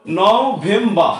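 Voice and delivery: নভেম্বর very slow, broken into syllables with open "baa" ending; model pronunciation. Shown as a demonstration. Teacher drills pupils on the word, slow syllable-by-syllable model. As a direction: stretch নভেম্বর out as no-vem-baa. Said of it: "আমরা পড়ি নভেম্বর".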